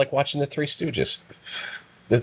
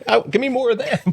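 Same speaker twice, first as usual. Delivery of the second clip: high, delighted cry for more; watching crapper videos, thrilled; gleeful; 12 semitones higher, 4 dB louder than usual. Retold or imitated. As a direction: squeal it like a kid wanting more. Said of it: "I'm like".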